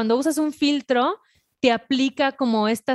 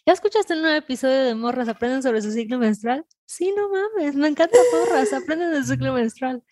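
bright mock ad voice, much higher; radio ad voice; 3 semitones higher than usual. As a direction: hype it like a podcast promo. Mock ad for the podcast.

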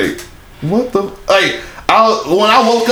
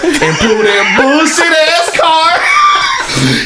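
laughing shout; 9 semitones higher, much slower than usual. Real, not acted. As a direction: crack up while yelling it out. Laughing loud outburst.

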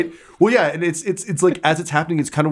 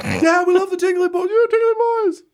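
very high yelled cheer; cheering crowd; faster than usual. Acted. Crowd answering Reginald's speech, high excited yell. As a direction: whoop it up like fans.

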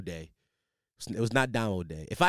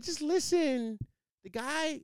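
imitating a whiny friend, much higher and slow; mock high pleading friend; high mock girlfriend voice; 7 dB quieter, much slower than usual. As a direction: put on a high breathy-girl voice, drawn out.

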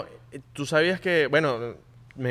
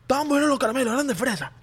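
put-on insisting kid; 10 semitones higher, faster than usual. Son insisting to mom he's fine, high childish pleading. Playing kid praising candies.

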